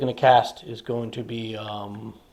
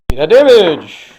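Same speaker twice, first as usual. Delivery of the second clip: excited dice call; 4 semitones higher, 9 dB louder, slower than usual. Real, not acted. Hyped damage roll.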